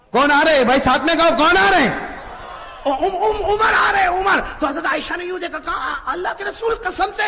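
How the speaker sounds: high, loud, fervent sermon voice; preaching at full force. Acted. Preacher telling the crowd about Umar, fervent shouting. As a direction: preach it to the rafters. Crowd call and Aisha's fright.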